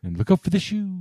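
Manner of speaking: high-pitched classic Hollywood diva starlet; Toni Storm. Retold or imitated. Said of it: "classic Hollywood diva starlet".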